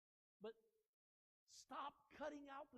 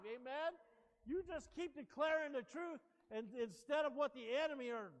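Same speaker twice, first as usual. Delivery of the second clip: fiery preaching; 4 semitones higher, 9 dB louder than usual. Acted. Pastor urging the congregation to declare the truth, fiery. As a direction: preach it full force.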